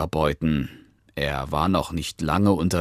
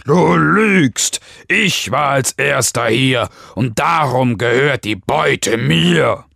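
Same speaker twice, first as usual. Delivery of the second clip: loud, high-pitched furious accusation; full-voiced, outraged bellow; angry roar; 5 semitones higher, 5 dB louder than usual. A narrator's reading. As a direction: roar it out like an enraged lion. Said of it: "brüllte der Löwe zornig zurück".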